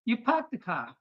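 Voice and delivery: hammed-up Boston, park the car; Boston accent. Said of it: "I said".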